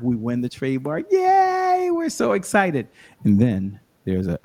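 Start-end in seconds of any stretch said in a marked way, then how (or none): 1.1-2.9 high, loud little yay; celebrating a win, much higher and louder; mock cheer. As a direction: cheer like you just won. Celebrating winning the trademark.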